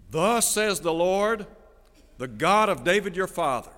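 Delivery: measured pulpit declaration; solemn scripture proclamation. Acted. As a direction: proclaim it like the voice of God. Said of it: "Now I want you to listen to what".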